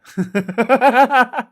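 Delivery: high burst of laughter; loud laugh. Real, not acted. Reacts to soothsayer joke.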